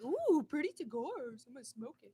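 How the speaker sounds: imitated kid. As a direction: coo like an imagined awestruck child. Mimics a kid admiring bands.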